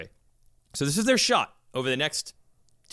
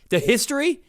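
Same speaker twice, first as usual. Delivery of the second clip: incredulous; 10 semitones higher, 4 dB louder than usual. Retold or imitated. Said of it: "And I'm like".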